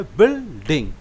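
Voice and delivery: "बिल्डिंग" with a clear gap, बिल, डिंग; split into syllables. Shown as a demonstration. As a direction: break "बिल्डिंग" into its two parts. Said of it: "दो भाग में बटा गया". ("बिल्डिंग" दो भाग में तोड़कर: बिल, डिंग)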